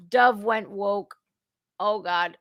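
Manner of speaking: ordinary talk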